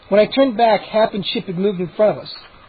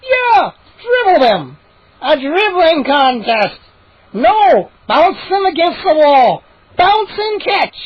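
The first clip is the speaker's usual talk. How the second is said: much higher, loud, gleeful shouts; excited gnome shouts; 9 semitones higher, 5 dB louder, slower than usual. Acted. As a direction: yell out cruel games with glee.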